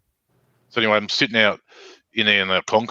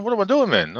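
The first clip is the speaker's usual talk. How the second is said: indignant protest; 10 semitones higher than usual. Retold or imitated. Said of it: "I said".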